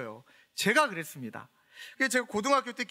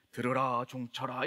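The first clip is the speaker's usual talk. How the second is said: lower, lightly intoned divine command; a hint of a booming heavenly voice; mock voice from heaven; 8 semitones lower, 3 dB quieter, slower than usual. Teen praying to hear God, imitating a lower divine voice. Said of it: "이런 게 막 들리게 해달라고".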